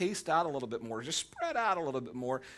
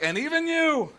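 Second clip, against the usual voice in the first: much higher and louder, near yelling; you too, really, today of all days; exasperated outburst; 13 semitones higher, 9 dB louder than usual. Pretend. Spouse starts a fight, you yell back in exasperation.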